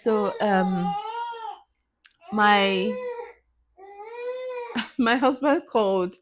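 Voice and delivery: crying through the words; tearful. Real, not acted. Tearful vlogger telling viewers about her husband's call, crying. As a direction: voice wet with real tears. Crying while recounting loss.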